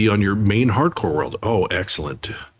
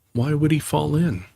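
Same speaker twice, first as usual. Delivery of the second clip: lightly higher Piglet; curious small pig; 7 semitones higher than usual. Acted. Piglet's question.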